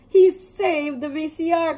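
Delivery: father cheering over the rescued VCR; excited cry. Acted. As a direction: cry it out in delight. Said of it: "cried father".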